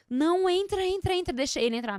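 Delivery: high-pitched, hurried invitation; retold eager "come in"; flustered urging. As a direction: urge them inside, re-enacting the fluster. Retells frantically letting father in.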